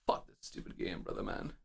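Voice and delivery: soft whispered grumble; defeated whisper. Real, not acted. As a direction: breathe it out, beaten. Giving up on the run.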